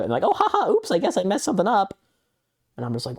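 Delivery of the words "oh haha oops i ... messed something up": very high, fast giggly fake oops; a scam bot pretending it messed up the invite; mock ditzy bot. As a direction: squeak out a phony laughing excuse. Mocking the bot's fake excuse.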